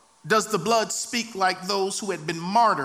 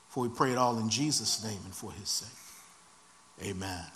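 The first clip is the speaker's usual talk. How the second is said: prayer; 9 semitones lower, 4 dB quieter than usual. Real, not acted. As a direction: pray in short, spaced petitions, sinking at the close.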